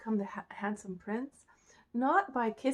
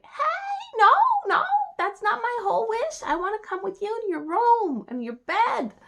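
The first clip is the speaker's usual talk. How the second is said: very high, loud frog objection; high insistent little frog; protesting frog; 12 semitones higher, 9 dB louder than usual. Acted. Frog protests being left behind.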